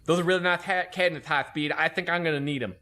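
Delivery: lisping impression of a chief; bad lisp. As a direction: slur every s into a th, mockingly. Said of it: "talked with a really bad lisp".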